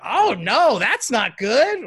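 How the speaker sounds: put-on high, loud, slow fretting old lady; grandma voice. Grandma reacts to the second goal, high and slow. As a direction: worry slowly like a grandma. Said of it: "She's like".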